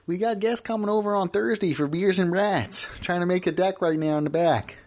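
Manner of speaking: a hint of a skit-comedy dad calming his wife; imitating a skit dad telling his wife to chill; Midwestern dad. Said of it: "skit guys".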